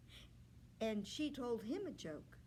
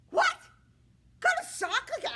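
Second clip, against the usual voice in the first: high, loud laughing scoff; a vain suitor mocking her question; scoffing disbelief; 13 dB louder than usual. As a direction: laugh off the very idea.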